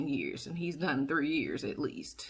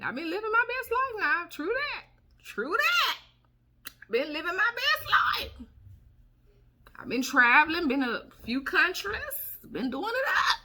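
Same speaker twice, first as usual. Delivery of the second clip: excited bragging; 11 semitones higher, 3 dB louder than usual. Real, not acted. Boasting of living her best life.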